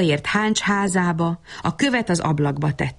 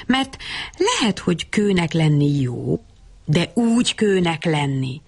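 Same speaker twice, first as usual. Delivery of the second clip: plain reading voice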